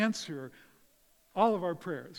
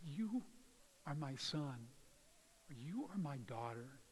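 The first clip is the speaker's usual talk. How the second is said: soft, unhurried words of parental love; tender reassurance; 7 dB quieter than usual. Retold or imitated. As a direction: gently reassure a child who is down, voice lowered. Voicing a parent comforting child.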